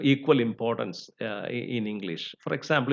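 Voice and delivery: ordinary delivery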